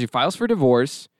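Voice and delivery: ordinary talk, nothing marked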